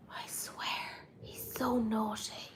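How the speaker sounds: slow, soft whispered aside. Real, not acted. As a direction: confide it in a hushed whisper. Aside about the dog.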